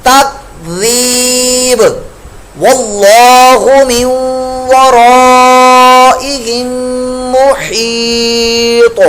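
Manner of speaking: slow Quran chant; Quran recitation. Shown as a demonstration. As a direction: chant each word long and full. Teacher recites the verse.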